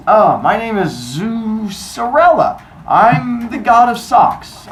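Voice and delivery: high, slow, drawn-out put-on voice; disguised Zeus. Zeus in disguise introducing himself to Cronus, high and slow. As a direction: stretch it out loud and high, making it up.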